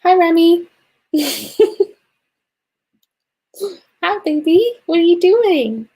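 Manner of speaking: high cooing voice for a pet. Real, not acted. Greeting a pet on camera.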